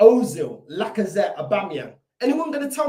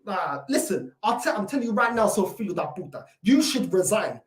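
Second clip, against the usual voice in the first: ordinary talk, nothing marked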